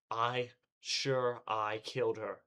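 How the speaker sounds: slow, rising-to-breaking disbelief; slow, desperate insistence. Acted. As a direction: drag each word out, losing grip. Breaking down, repeating.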